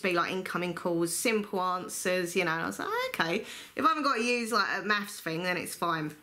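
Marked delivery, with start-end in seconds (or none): 2.9-3.4 high relieved oh; sudden relieved pitch jump; relieved surprise. Retold or imitated.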